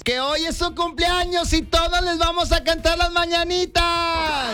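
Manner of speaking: put-on high children's host voice, laughing; kids show host. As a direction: play a cheerful kids TV host. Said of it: "La animadora infantil".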